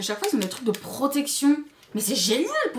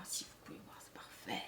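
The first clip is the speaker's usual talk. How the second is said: whisper; 20 dB quieter than usual. Real, not acted. Close-up demo of brush texture.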